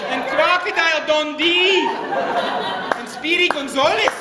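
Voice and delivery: wandering, muddled Scottish brogue; hammed-up mongrel Scottish accent for laughs; terrible Scottish brogue. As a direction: toast in a badly faked Scottish accent. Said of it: "my Scottish brogue, ... now sounds so terrible".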